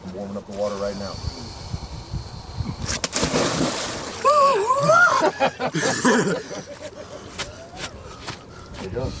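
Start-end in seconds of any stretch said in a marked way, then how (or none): 1.2-7.7 big laugh shouted out. Real, not acted.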